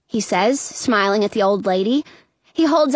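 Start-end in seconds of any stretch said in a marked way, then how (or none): none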